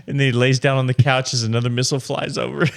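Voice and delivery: fast, giggling through the words; laughing while talking. Real, not acted. Joking about the Yemen ad.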